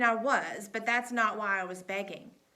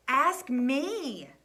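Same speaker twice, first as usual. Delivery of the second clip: firm insistence; 4 semitones higher, 6 dB louder than usual. A narrator's reading. Coach insisting on being asked.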